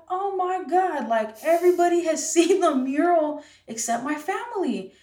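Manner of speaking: high-pitched, animated retold dismay; acting out an alarmed "oh my God"; dismayed exclamation. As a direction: exclaim it like a sudden realization. Retelling her dismayed reaction.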